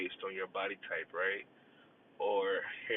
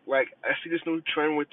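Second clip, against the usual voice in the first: ordinary talk, nothing marked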